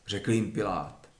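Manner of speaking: ordinary delivery, nothing marked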